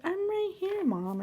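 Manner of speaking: put-on very high-pitched little-kid voice; imitating daughter. Daughter answering her frightened mom, imitated high child voice. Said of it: "she was like".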